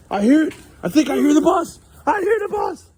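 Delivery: high, loud, eager announcement; excited. Real, not acted. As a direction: call it out like the bus is finally here. Mother hears the school bus coming.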